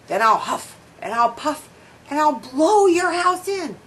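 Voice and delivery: a hint of a howling wolf; wolf howls. A narrator's reading. Wolf threatens the pig's house, mild howl. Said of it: "the wolf howls".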